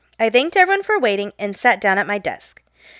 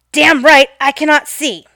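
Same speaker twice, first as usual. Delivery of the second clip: retold angry yell; 4 semitones higher, 5 dB louder than usual. Retold angry customer yelling.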